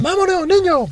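very high squeaky mock newborn; mock baby voice. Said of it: "en plan". (voz de bebé chillona)

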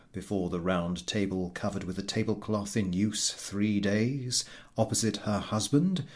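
usual speaking voice

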